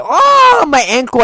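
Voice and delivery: mock the player wailing about his ankle; mock whimpering player. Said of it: "he starts crying and whimpering".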